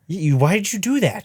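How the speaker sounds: high scolding mom voice; a mom scolding her kid. Retold or imitated. Voicing the scolding wife.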